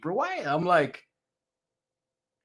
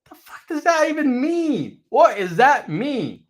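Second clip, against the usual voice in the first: baffled outburst; 10 semitones higher, 5 dB louder than usual. Retold or imitated. His confused reaction.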